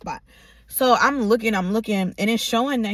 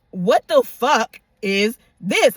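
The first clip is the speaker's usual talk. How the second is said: slow, loud word-by-word demand; indignant confrontation; 5 dB louder, much slower than usual. Retold or imitated. Woman pulling up at his house to confront him, slow indignant demand. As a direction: spell out each word in outraged disbelief.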